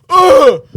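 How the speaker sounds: loud, high groan of revulsion; disgusted cry. Retold or imitated. Re-enacting his shock at the man, loud revolted cry. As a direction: blurt out a comic yelp of disgust.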